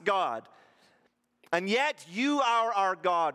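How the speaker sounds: anguished prayer. Retold or imitated. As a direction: cry out to God in grief and confusion. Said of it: "I can imagine, like".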